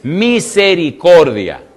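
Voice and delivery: Misericordia stretched slow, each syllable leaned on; drawn-out emphasis. Shown as a demonstration. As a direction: savour the word Misericordia. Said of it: "qué dice que guarda".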